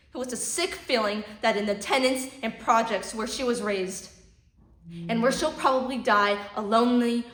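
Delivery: loud, building fury; rising anger. Acted. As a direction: let the rage build to the end. Rant builds to a climax.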